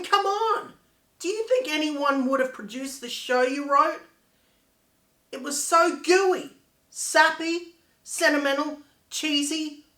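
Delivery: loud, indignant confrontation; angry outburst. Acted. Confronting Kim over a lost chance with the show, angry outburst. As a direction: lash out, pushing the key words hard.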